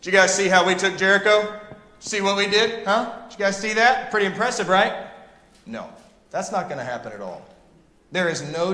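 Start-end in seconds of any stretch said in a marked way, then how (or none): 0.0-5.1 mock boasting. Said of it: "the Israelites are gonna say".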